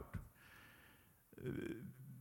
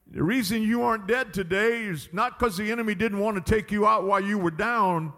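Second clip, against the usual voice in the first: ordinary delivery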